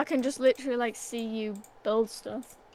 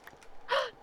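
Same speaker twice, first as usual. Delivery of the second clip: surprised gasp; 13 semitones higher, 8 dB quieter than usual. Real, not acted. Spots dirt from gravel.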